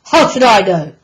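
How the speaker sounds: loud grumpy grunt. Acted. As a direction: grunt it back like a cross pig. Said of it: "Mister Piggot grunted".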